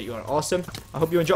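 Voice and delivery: usual speaking voice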